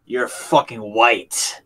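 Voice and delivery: low, slow mock accusation; retold taunt. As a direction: drag it out low and slow, imitating the heckler. Retold confrontation, slow low jab.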